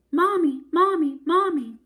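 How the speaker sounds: put-on little girl screaming for her mother; screaming for Mommy. A narrator's reading. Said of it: "ran up the stairs screaming".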